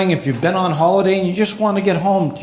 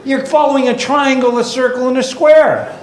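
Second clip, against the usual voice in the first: exasperated rant; 8 semitones higher than usual. Acted. Comic ranting at signs he must follow. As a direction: rant up high at the baffling road signs.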